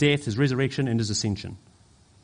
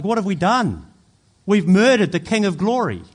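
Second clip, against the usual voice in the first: loud, higher-pitched cry of horrified regret; 6 semitones higher, 6 dB louder than usual. Acted. Preacher voicing the guilty crowd, horrified regret. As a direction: voice a crowd realising its terrible mistake.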